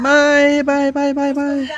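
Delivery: high, bright call of goodbye; cheery bye. Real, not acted. Father calling bye to kids, high and cheery.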